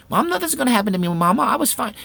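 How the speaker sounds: put-on high, fast boyish protest; a boy brushing off his mother's worry; child protesting. Boy arguing with his mother, high fast protest. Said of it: "son protesting to mother".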